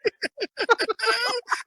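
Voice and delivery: high burst of laughter. Real, not acted. Laughs at own remark.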